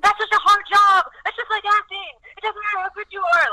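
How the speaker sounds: high, laughing exasperated mom; laughing mom pleading with her daughter; mock exasperated mom. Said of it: "And I'm like".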